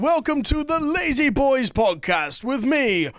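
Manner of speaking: big, drawn-out show announcer intro; X Factor announcer. Acted. Host introducing his podcast like the X Factor announcer. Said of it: "Is this supposed to be the X Factor guy?".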